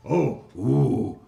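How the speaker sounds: loud, delighted hobgoblin; pleased hobgoblin boss. Acted. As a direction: purr with greedy pleasure.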